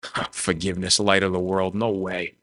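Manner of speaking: low, soft scoffing to himself; inner battle. Acted. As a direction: mutter it dismissively, in broken bits, with a huff. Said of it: "he's battling back and forth".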